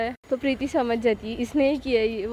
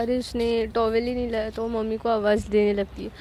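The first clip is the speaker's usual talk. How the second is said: ordinary delivery, nothing marked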